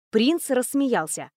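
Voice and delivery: plain reading voice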